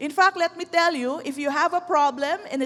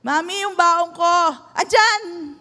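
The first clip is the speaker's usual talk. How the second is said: put-on higher, louder child's call; child calling mom; 4 semitones higher, 5 dB louder than usual. Child asking mommy for baon, calling out. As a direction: pipe up like a kid asking after lunch money. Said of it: "Minsan sabihin ng bata".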